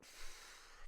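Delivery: soft sigh out; showing a sigh breath; demo sigh. Shown as a demonstration. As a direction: let the air drop out. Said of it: "when they breathe out".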